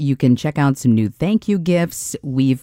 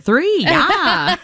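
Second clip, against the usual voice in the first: exaggerated Minnesotan twang, high and laughing; Minnesota accent; 12 semitones higher than usual. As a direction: ham up a Central Minnesota accent. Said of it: "my Midwestern Central Minnesota voice came out".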